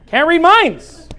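high, loud and stretched out; loud insistence. Real, not acted. Pastor driving the point home to a wife, loud and insistent. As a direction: hammer it home slowly at full voice. Repeats point loudly to listener.

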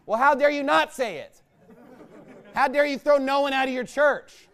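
ordinary talk, nothing marked

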